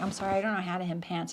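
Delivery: quick, soft deadpan excuse; dead serious. Retold or imitated. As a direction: deliver the fib fast and straight-faced. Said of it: "I'm being dead serious".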